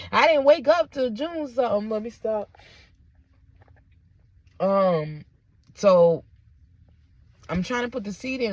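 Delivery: ordinary talk, nothing marked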